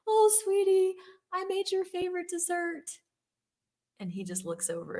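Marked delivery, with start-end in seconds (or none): 0.1-2.9 high-pitched, cooing mom voice; imitated motherly fussing, pitched way up; doting mother. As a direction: gush like a mother spoiling her boy.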